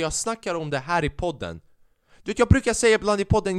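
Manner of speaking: ordinary talk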